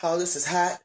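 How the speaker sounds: ordinary talk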